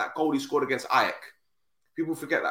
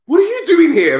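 loud, high startled greeting; high surprised yell across the airport; surprised shout; 13 dB louder than usual. Retold or imitated. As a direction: blurt it out, caught off guard.

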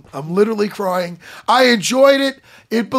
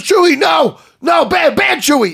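higher, louder mock scolding of a dog; scolding shout; 7 semitones higher, 5 dB louder than usual. Han scolding Chewie like a dog, mock shouting. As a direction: yell at Chewie like a naughty pet. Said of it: "He goes".

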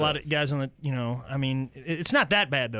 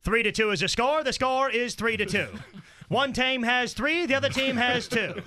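mock radio commentary voice; sports broadcaster; 11 semitones higher than usual. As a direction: parody a sportscaster with stretched key words. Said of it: "his idea of the perfect broadcast would be this".

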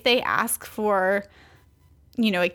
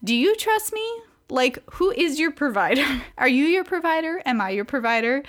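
a lightly voiced God, raised and probing; a hint of God asking, higher voice; God questioning; 5 semitones higher than usual. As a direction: ask it gently as God might, higher up. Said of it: "felt God kind of being like".

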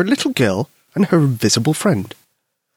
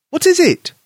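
put-on higher eager child question; high curious child; 4 dB louder than usual. Albert asking from below, high eager voice.

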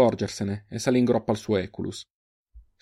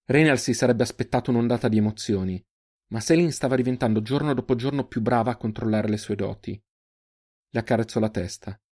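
ordinary delivery, nothing marked

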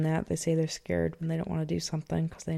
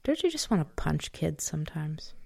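whispering; 5 dB quieter than usual. Real, not acted. Joking aside to partner.

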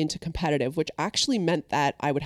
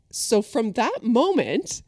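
usual speaking voice

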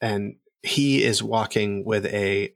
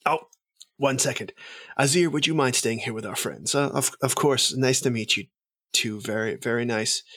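courtly noble announcing a guest, lightly raised pitch; formal announcement; 3 semitones higher than usual. Acted. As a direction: present the emissary with ceremony. GM voices noble introducing emissary.

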